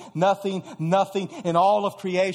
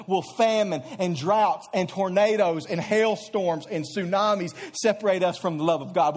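usual speaking voice

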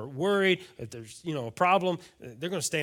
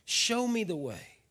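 soft prayer; 3 semitones higher, 4 dB quieter, slower than usual. Pretend. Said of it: "You say".

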